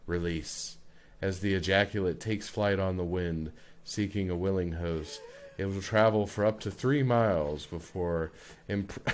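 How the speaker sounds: low, soft, solemn nature narrator; documentary voice. Acted. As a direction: keep the wildlife narration measured and deadpan. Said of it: "do in a documentary voice".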